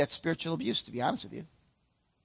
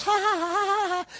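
high taunting ha ha; mocking kid laugh; 12 semitones higher than usual. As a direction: laugh at them like a bratty kid. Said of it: "their kid goes".

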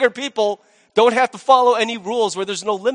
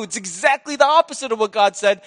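ordinary talk, nothing marked

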